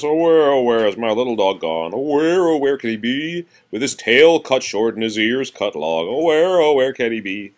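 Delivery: sing-song rhyme. Acted. Parent reading a rhyme to child, sing-song. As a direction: lilt the where lines like calling a lost dog.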